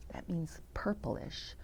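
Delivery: whispered aside. Real, not acted. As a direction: whisper it, a quick explanation. Aside explaining a word mid-story.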